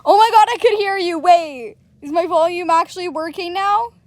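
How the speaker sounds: fast, high, loud rush; excited. Real, not acted. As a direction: blurt it out thrilled. Excited, voice works again.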